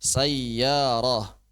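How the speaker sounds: normal talking voice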